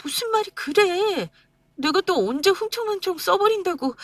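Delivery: wife defending buying beef for mother; indignant wife. Acted. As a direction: push back, hurt and indignant. Wife protesting, mother present.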